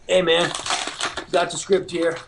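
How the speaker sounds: casual thick East Coast guy, throat-clearing; East Coast accent. Retold or imitated. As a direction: imitate an offhand East Coast voice actor. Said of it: "thick East Coast accent".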